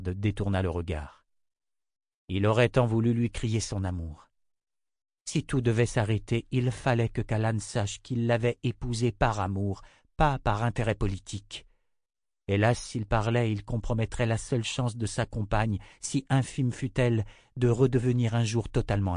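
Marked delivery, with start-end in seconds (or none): none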